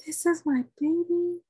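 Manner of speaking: high, slow, dreamy gushing; high, dreamy self. Retold or imitated. New mother holding her baby, dreamy and high. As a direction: coo it out like you're floating. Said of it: "And I'm like".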